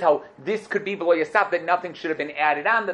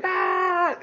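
congregant's yell; 14 semitones higher than usual. Retold or imitated. Shocked visitor recalls everybody at shul yelling, mock yell. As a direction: imitate a shul full of yelling. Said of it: "yelling and screaming".

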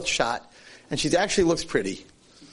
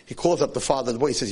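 ordinary delivery

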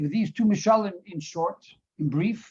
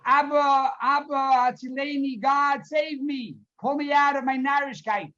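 high, raised-voice appeal to Father; acted pleading cry; 6 semitones higher than usual. Voices a desperate plea to God.

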